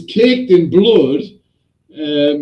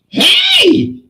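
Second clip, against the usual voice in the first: high, loud "hey" yell; explosive shout; 5 dB louder than usual. Retold or imitated. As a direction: burst in with a high-pitched holler. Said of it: "quite an explosive personality".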